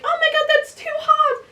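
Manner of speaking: high, squeaky mock whine; whiny crybaby. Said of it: "she was a crybaby".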